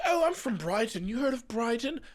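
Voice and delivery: put-on British accent. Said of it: "I'm British".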